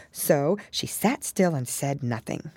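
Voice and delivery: ordinary delivery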